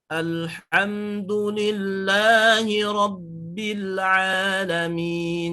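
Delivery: slow, sung alhamdulillahi rabbil alamin that flows straight on; the correct demo, slow and sung; smooth recitation. Shown as a demonstration. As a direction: chant it straight through with no bounce. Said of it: "Jadi langsung masuk".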